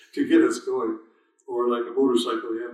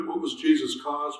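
ordinary talk

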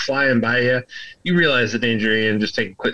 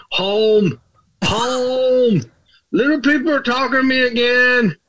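ogre shouting for someone named Home, pitched way up; hollering ogre; 13 semitones higher, slower than usual. Acted.

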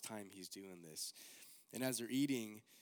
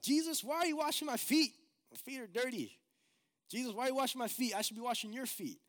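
high, loud, pleading disciple voice; a much higher, flustered Peter who can't believe it; protesting Peter; 12 semitones higher, 6 dB louder than usual. Acted. Peter protests Jesus washing feet.

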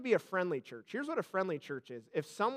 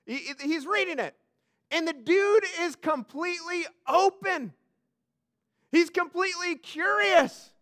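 loud, higher preaching; fervent preaching; 7 semitones higher, 7 dB louder than usual. Acted. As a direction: build excitement for the congregation. Preacher building intensity.

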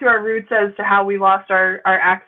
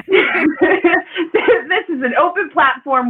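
higher, drawn out through laughter; amused, giggly declaration; laughing protest; 7 semitones higher, much slower than usual. Real, not acted. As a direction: laugh your way into the words. Laughing while backing host's mock defiance.

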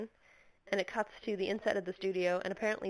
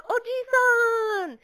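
much higher, slow drawn-out cry; Usagi calling out; 7 dB louder, much slower than usual. Retold or imitated. Usagi calling to her uncle, high drawn-out cry. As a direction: stretch it out high like a cartoon girl. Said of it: "Usagi shows up and she's like".

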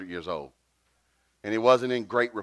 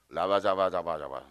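low, monotone nonsense syllables; mock translator; 6 semitones lower than usual. Translator relaying the welcome, mock low monotone gibberish. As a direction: imitate a deadpan translator in gibberish. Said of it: "And this is my translator".

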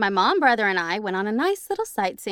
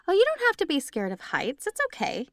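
sweet ghost lady; 8 semitones higher than usual. Retold or imitated. Ghost lady reassuring a mom afraid of heights, sweet. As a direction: coax the scared mom gently, lightly voiced.

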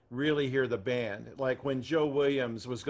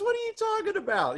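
pitched way up in a squeaky falsetto; high-pitched disbelief; high surprised voice. Retold or imitated. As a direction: squeak it out like he's been caught off guard. Ray's surprised reply, quoted.

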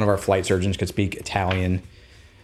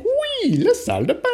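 eager tourist French. Retold or imitated. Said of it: "I'm like".

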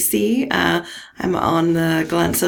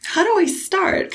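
exasperated; 13 semitones higher, slower than usual. Retold or imitated. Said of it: "and I'm like".

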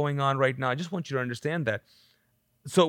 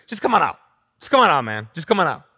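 loud, exasperated urging; acted weary impatience at raised volume; Jesus, tapped out; 7 dB louder, faster than usual. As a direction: order him out as if fed up. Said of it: "emotionally tapped out".

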